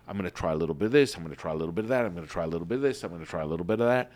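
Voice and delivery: fast and loud, punching try each time; stress on TRY. Shown as a demonstration. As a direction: hit the word try hard as you rattle it off. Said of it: "Emphasis on the word try".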